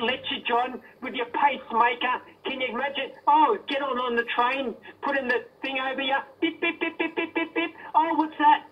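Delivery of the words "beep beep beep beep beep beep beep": mock beeping a bit higher; imitated scanner beeps. Caller mocking the host's pacemaker, imitated scanner beeps. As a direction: beep beep like a metal detector going off.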